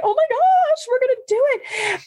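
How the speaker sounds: high, thrilled exclamation; joyful excitement. Retold or imitated. Wife deciding to adopt, high thrilled joy. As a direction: burst out with joy. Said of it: "that joy of".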